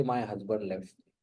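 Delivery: a hint of a widow weeping over her husband; lightly voiced widow answering why she cries; mild tearful widow. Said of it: "She'll say".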